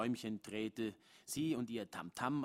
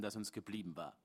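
usual speaking voice

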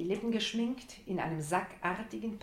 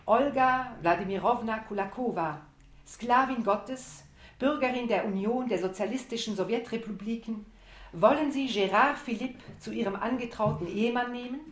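solemn priest; 4 semitones higher, 6 dB louder than usual. Retold or imitated. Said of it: "Du spielst den Priester".

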